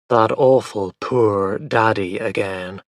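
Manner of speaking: plain reading voice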